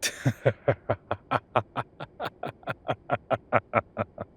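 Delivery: loud rolling laugh; amused laugh. Acted. Professor laughing at his assistant's cheeky joke, loud laugh. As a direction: let a long laugh roll out at the joke.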